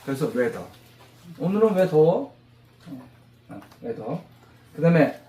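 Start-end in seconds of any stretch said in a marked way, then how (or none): none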